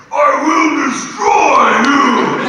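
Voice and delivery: high, loud, slow roaring threat; villain shout. Retold or imitated. Actor recreates his audition line, shouting as the villain. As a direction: bellow it like a cartoon villain. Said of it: "just going like".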